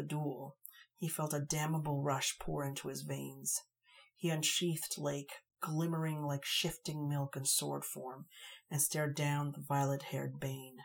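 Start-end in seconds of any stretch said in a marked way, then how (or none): none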